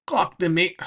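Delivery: put-on Cockney accent. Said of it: "way more indecipherable than that".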